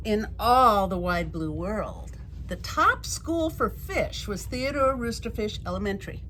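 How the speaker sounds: ordinary delivery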